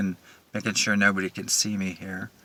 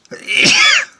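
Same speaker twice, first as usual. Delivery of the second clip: sharp cough; 10 dB louder than usual. Real, not acted. Pepper up the nose.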